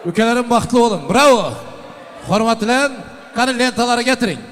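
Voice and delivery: loud, high cheering call; booming wedding announcer. Real, not acted. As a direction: shout it over the band. Host cheering over music.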